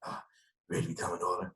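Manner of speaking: imitating the coach's hushed, breathy phone voice; Jeff Fisher's voice. Head coach calling the new draft pick, soft whispery rasp. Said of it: "Jeff Fisher talks like this".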